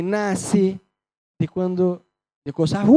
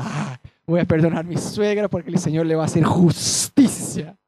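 a hint of a smug churchgoer; lightly mock the one who forgives for revenge; mock forgiver; 4 semitones lower, 4 dB louder than usual. (tono burlón de creyente interesado)

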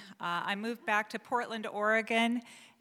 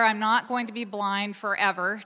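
normal talking voice

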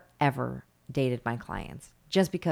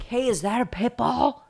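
loud, raised-pitch imitation of a stranger; passerby calling out; 9 semitones higher, 6 dB louder than usual. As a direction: call it out to a dog walker. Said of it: "somebody was like".

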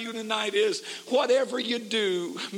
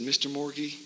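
lower, soft and gentle; tender bedside voice; 8 semitones lower, 6 dB quieter than usual. Retold or imitated. Preacher answering the dying man, hushed and tender. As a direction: speak softly to a dying man.

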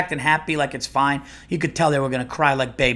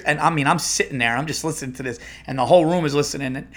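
ordinary delivery

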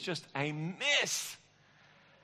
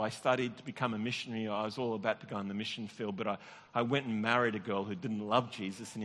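usual speaking voice

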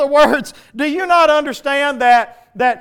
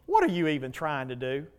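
low, much softer sneering voice of the enemy; enemy's whisper in the ear; 8 semitones lower, 11 dB quieter than usual. Acted. The enemy taunting a believer in his ear, low soft sneer. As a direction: hiss doubt into someone's ear. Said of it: "the enemy yell in your ear".

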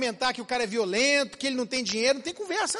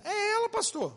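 whiny blaming husband; 10 semitones higher, 4 dB quieter than usual. Retold or imitated. Husband blames wife to pastor.

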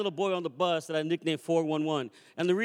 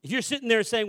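ordinary talk

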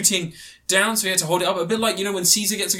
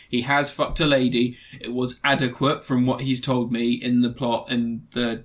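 normal talking voice

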